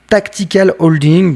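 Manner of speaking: English name read with a French accent. Real, not acted. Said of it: "Désolé pour mon accent anglais".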